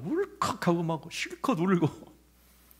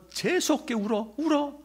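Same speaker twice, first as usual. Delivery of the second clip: sharp high mocking yell at a child; stepmother's harsh shout, mimicked high; retold angry yell; 13 semitones higher than usual. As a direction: throw the words like a scolding stepmother, much higher. Stepmother yelling at crying child.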